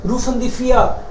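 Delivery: normal talking voice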